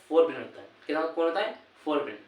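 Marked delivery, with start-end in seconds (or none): none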